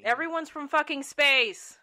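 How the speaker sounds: very loud, high, drawn-out outburst; exasperated shout. Real, not acted. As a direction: yell it at your co-host, slow and emphatic. Shouted argument over Star Wars accents.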